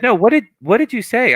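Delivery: mock stern teacher insisting; pressing teacher. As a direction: press the student for an answer.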